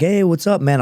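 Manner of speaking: mock a cheery pick-up, pitch jumping up; retold upbeat greeting. Marine answering his old superior's phone call, retold upbeat greeting.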